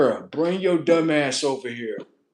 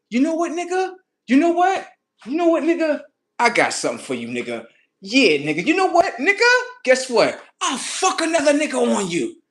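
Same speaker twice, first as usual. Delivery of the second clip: put-on upset girlfriend; 13 semitones higher, 6 dB louder than usual. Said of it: "She like".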